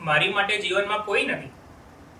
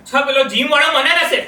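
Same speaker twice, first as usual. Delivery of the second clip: loud, higher-pitched angry accusation; jealous suspicion; 5 semitones higher, 8 dB louder than usual. Acted. Husband suspecting the gym guy, loud and angry.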